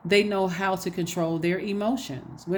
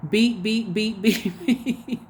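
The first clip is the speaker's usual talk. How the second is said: mock censor bleeps; 3 semitones higher, much slower than usual. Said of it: "blankety, blank".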